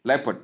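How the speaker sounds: "Leopard" as two syllables, LEP-erd; correct leopard. Shown as a demonstration. Teacher contrasting word number seven, leopard pronounced LEP-erd. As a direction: say "Leopard" like "leppard". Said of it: "but pronounced as leopard".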